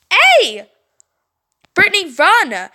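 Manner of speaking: loud high warning; urgent shout. Acted. Stacy shouts to Brittany to flee, loud. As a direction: yell at her to run.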